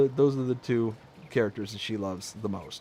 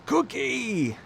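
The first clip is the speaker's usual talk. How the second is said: cookie cry; 11 semitones higher than usual. Retold or imitated. Quoting a daughter's favourite character.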